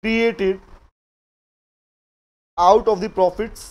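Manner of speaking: each word drawn out slowly for note-taking; very slow classroom dictation. Shown as a demonstration. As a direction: dictate it slowly so students can write. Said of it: "कौन सा profit लिखो?".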